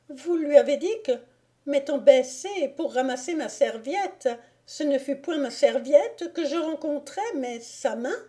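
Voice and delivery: high, scandalised lady's question; aghast lady. A narrator's reading. Lady asking the lord what he revealed, aghast. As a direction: pile on detail in high disbelief.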